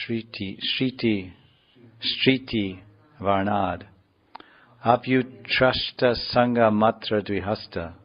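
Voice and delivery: emphatic Sanskrit verse reading; declaimed scripture, slowing at the close; verse recitation. Acted. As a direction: recite it firmly, phrase by phrase.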